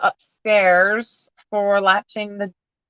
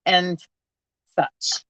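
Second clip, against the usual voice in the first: normal talking voice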